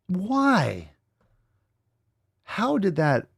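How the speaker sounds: shocked, can't believe what you just saw; disbelief. Real, not acted. Reacting to a shocking video.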